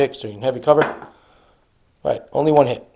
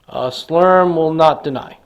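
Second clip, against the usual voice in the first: ordinary talk, nothing marked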